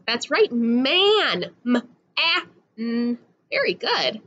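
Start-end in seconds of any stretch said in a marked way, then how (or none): 0.5-1.5 "man" with a long held a; slow phonics "man"; stretched-out word. Shown as a demonstration. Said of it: "has that ah in the middle". 1.7-3.2 "M", "ah", "n" as three separate sounds; sounded out. Shown as a demonstration. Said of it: "has that ah in the middle".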